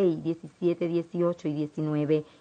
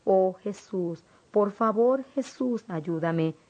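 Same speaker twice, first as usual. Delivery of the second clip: mild cry of pain; 3 semitones higher than usual. A narrator's reading. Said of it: "Yo grité con grande dolor".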